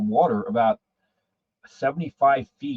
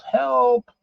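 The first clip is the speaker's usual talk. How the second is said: high yell for help, not much louder; retold yell for help; 12 semitones higher, 3 dB louder than usual. Drowning man yelling to the lifeguard, high mock yell. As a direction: call out to the distant lifeguard. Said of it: "I, I yelled".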